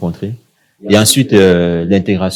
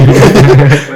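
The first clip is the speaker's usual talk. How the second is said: loud laugh; 6 semitones higher, 9 dB louder than usual. Real, not acted. Laughs at his own lunch.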